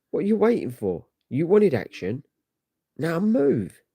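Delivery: high, urgent call to move out; urgent battle command. Acted. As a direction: push them into the fight. Hero urging comrades into battle.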